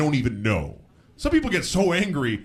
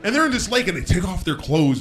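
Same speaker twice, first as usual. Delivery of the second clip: ordinary talk, nothing marked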